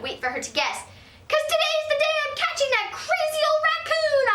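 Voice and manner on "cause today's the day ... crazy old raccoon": put-on high, loud triumphant kid shout. Girl telling mother she will catch the raccoon, shouting high. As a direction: announce it at the top of her voice. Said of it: "I shouted".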